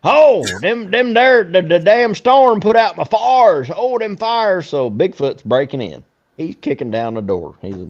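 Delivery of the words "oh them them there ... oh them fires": higher, louder, slow hammed-up hillbilly moaning; mock hillbilly lament, pitched up and loud. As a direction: ham up a hillbilly whining about his fires. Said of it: "the hillbilly comes in".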